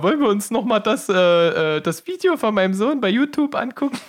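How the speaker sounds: high sweet mom voice; imitating mom. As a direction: play the doting mother, very high. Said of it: "deine Mama sagt ja jeden Abend zu mir". (hohe, süßliche Mama-Stimme)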